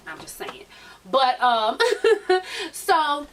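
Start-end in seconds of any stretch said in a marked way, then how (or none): 1.8-2.7 laughing. Real, not acted. Laughs at own story.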